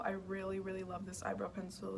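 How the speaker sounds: normal talking voice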